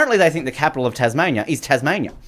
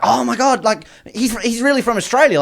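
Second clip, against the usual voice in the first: high, gushing imitation of a friend; imitating breathless fan excitement; mock excited friend; 10 semitones higher than usual. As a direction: gush it to the waitress, pitched way up. Retells friend telling the waitress.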